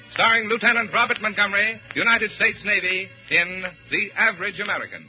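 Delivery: ordinary talk, nothing marked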